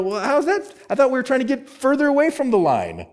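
high-pitched, quick protest of confusion; puzzled objection. Acted. Preacher voicing a confused listener who questions him, puzzled protest.

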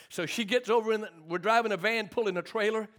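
ordinary delivery

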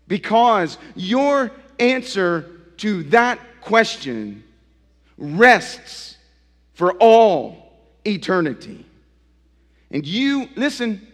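loud, rising sermon delivery, pressing each phrase; preaching at full force. Acted. Pastor challenging his congregation, preaching loud and high.

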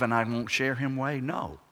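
ordinary talk

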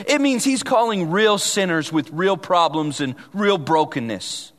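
normal talking voice

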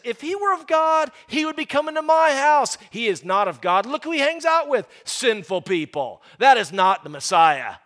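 high, loud self-righteous scorn; mock the hypocrites loudly; preacher voicing smug Pharisees, scornful; sneering religious crowd. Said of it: "The religious crowd is saying this".

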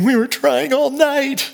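desperate whine. Retold or imitated. Peter complaining to Jesus about a night of no fish, desperate high whine. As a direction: stretch it out like you've given up. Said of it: "this is desperation that he's doing".

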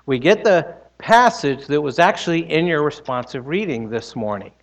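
usual speaking voice

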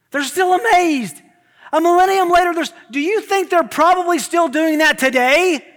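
loud, high, driving sermon voice; full-force preaching. Acted. Pastor building excitement about heaven's throne, preaching loud. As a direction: preach it to the back row.

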